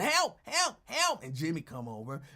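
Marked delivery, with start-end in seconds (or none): 0.0-1.2 high, loud hammed-up help help help; mock screaming for help. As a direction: squeal it out like a panicked man. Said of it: "Lincoln screaming".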